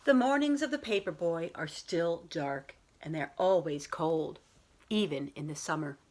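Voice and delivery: put-on children's picture book read aloud to a class; story time voice for young listeners; storybook reading. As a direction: read it like a teacher sharing a bedtime story. Teacher reads picture book aloud.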